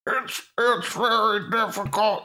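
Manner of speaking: high, strained croaky frog puppet; Kermit with laryngitis. Acted. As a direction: struggle through a sick puppet-frog voice. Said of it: "combine the laryngitis sound with a Kermit voice".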